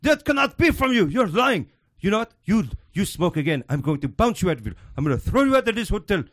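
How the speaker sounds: hammed-up Indian accent scolding a guest; imitating Indian manager. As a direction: Indian accent, angry hotel manager threatening to throw him out. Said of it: "this little Indian guy comes out".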